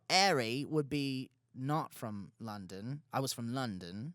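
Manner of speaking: put-on low, slow London accent; slow mock British voice, dropped low; British dude. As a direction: put on a not-very-good London bloke accent. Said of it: "But I wasn't very good at it".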